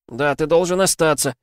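put-on high, loud begging child voice; pleading child. A narrator's reading. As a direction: beg him to stay. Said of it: "умолял Филлер".

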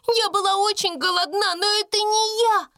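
put-on very high, wailing servant girl; acted tearful wail; sobbing protest. A narrator's reading. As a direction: plead tearfully in a high little voice. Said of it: "рыдая, оправдывалась Бекки".

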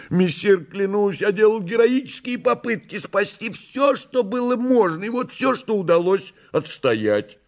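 high, theatrical cat voice; Behemoth the cat, protesting. Acted. Behemoth swearing he tried to save everything, high protesting voice.

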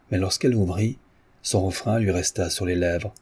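usual speaking voice

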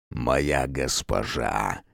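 низко и тихо тянуть слова; тяжело выдавить обращение; тихо, медленно. A narrator's reading. Темнейший обретает речь. (тихо и медленно)

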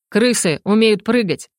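ordinary delivery

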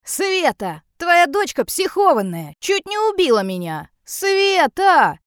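high, indignant yell for help. A narrator's reading. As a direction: call out in outrage, loudest on the final name. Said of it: "открывает дверь и орёт".

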